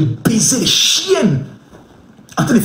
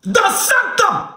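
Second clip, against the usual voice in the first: high-pitched urgent call; high, hyped-up crowd shout; excited calling out; 12 semitones higher than usual. Real, not acted. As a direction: call out high for gifts to rain in. Calls out to viewers for gifts.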